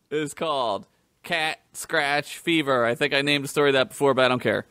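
ordinary delivery

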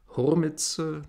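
usual speaking voice

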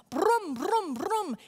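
loud mock engine rumble; motorcycle engine imitation. Telling the dream story to the class, mock motorcycle roar. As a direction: rev it like a big loud cycle.

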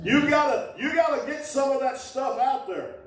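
high, pushing exhortation; urgent preaching. Real, not acted. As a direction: urge them on, pitch up. Preacher urging congregation.

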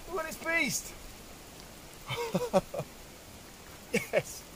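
laughing with joy at the find; excited. Real, not acted.

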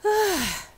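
high, loud trunk sigh; a huge beast sighing; mammoth sigh. A narrator's reading. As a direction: blow a big sigh through a trunk. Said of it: "The mammoth sighs through its trunk".

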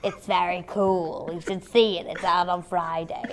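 deadpan. Pretend. Mock-serious plug of movie.

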